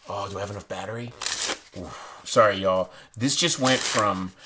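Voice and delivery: normal talking voice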